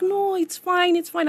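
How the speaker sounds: high, loud imitated husband; dismissive reassurance. Wife imitating husband waving away her worry, high-pitched reassurance. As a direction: brush the worry off as him, breezily.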